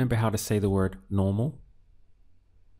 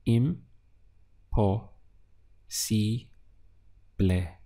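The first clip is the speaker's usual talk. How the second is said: im, po, si, ble split with pauses between; syllable by syllable; slower than usual. Shown as a demonstration. Teacher breaking imposible into syllables for the class. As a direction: tap out each syllable of imposible. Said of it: "Let's look at the syllables again".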